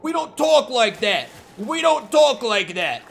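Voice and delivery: mock-angry New York woman telling him off; half-hearted angry scold in a New York accent; angry New Yorker. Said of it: "comes up to him angrily".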